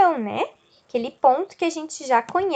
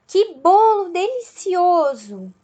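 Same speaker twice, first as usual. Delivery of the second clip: higher, drawn-out delighted exclamation; joyful exclamation; 4 semitones higher, 3 dB louder, slower than usual. Shown as a demonstration. Teacher showing students an exclamation with joy, drawn-out and delighted. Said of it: "falando algo com alegria".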